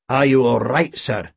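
put-on henchman voice; thuggish sidekick. A narrator's reading. Boyle edging toward his chief, thuggish concern. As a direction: check on the boss as his henchman.

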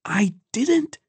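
very high, desperate inner denial; high-pitched silent protest; protesting thought. A narrator's reading. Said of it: "thought Ekon".

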